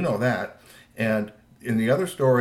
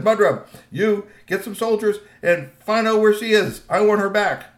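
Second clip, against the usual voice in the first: loud, higher, urgent orders; commanding father; 8 semitones higher, 7 dB louder than usual. A narrator's reading. Troll father sending soldiers after his daughter, commanding. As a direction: give forceful orders with raised voice.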